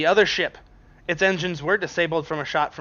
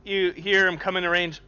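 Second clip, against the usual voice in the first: ordinary talk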